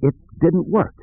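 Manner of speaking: high, halting admission; hesitant, high-pitched confession. A narrator's reading. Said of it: "Kip answered in a halting voice".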